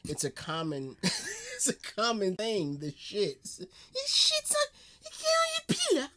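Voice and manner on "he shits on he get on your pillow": very high, squeaky incredulity; cracked up, voice shooting way up; laughing disbelief. Real, not acted. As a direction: squeal it through helpless laughter.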